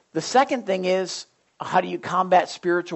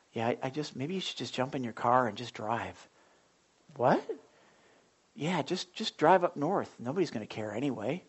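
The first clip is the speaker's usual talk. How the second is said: a lightly voiced soft, low tempter; tempting inner voice; 4 semitones lower, 5 dB quieter than usual. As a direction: coax him quietly toward giving up. Enemy's tempting voice in his head.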